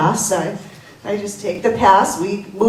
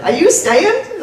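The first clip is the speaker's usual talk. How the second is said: high rising Southern drawl; mock the gate lady's Southern question; southern accent; 11 semitones higher, 4 dB louder, slower than usual. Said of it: "running joke".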